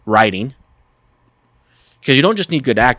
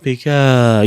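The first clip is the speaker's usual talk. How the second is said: slow, raised, elongated Pikachu-style delivery; drawn out; 3 semitones higher, 4 dB louder than usual. Shown as a demonstration. Co-host showing how Pikachu varies his one word, drawn out. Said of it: "he changes the inflection".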